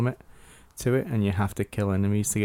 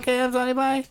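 put-on squeaky kid gamer; faster than usual. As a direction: play an overexcited twelve-year-old fan. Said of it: "a twelve, thirteen-year-old kid".

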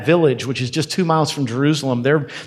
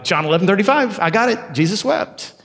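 put-on higher, childlike sing-song recital; eager child reciting; 5 semitones higher than usual. Child showing off the easy verse to teacher, eager and higher. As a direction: chirp the verse like a proud Sunday school kid. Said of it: "and you were like".

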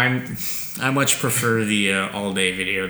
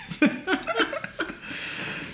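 breathy laugh; 9 dB quieter than usual. Real, not acted. Laughing at "Find Alex.

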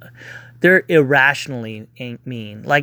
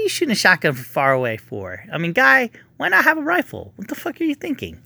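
incredulous rant; 6 semitones higher, faster than usual. Real, not acted. Ranting at movie character, incredulous.

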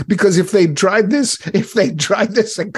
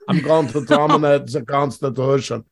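Terminator impression. Cohost jokes about the Terminator, mock robot voice. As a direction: play it as a movie cyborg, deadpan.

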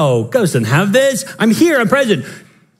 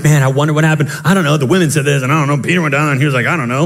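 low, dazed disciples; 7 semitones lower, much faster than usual. Retold or imitated. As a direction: lower voice, mumbled puzzlement. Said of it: "They're like".

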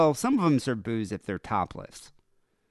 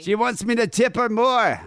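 much higher, louder mock old-man whine; mock old man complaining; 13 semitones higher, 5 dB louder than usual. Old man at the strip club complains about tipping, mock whine. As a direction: squeak it out like a stingy old man.

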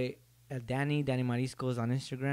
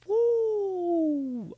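a sudden high, loud whoop; high woo; 7 dB louder than usual. Retold or imitated. Vlogger quitting cold turkey, high whoop. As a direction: let out a shrill woo as the feelings burst. Emotions released after quitting.

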